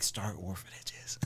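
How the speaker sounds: slow, whispered divine command; mock voice from heaven. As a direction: breathe it like a voice from above. Divine voice told in story.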